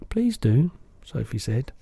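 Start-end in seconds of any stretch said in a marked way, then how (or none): 0.1-0.7 put-on light, higher girl's voice; a curious little girl protesting; Sophie's voice. Girl correcting the giant, light and higher.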